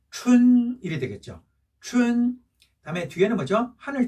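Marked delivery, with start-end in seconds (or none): 0.1-0.8 "춘" long, on a level raised pitch; first tone. Shown as a demonstration. Teacher answering for spring, "춘" long level tone. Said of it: "일 성이니까". 1.8-2.4 "춘" long, on a level raised pitch; first tone. Shown as a demonstration. Teacher answering for spring, "춘" long level tone. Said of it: "일 성이니까".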